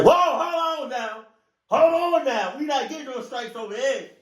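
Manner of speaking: very high, fast mock-alarmed voice; alarmed protest. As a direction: squeak out a nervous warning. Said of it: "I'm about to say".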